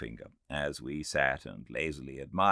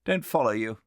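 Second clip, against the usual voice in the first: higher, louder blunt reply; puzzled Philby; 7 semitones higher, 6 dB louder, faster than usual. Acted. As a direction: toss it back curtly. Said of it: "said Philby".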